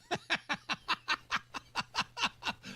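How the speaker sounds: airy high laughter after the bit. Real, not acted. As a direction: laugh at your own joke.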